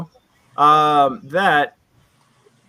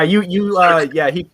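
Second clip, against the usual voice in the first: ordinary talk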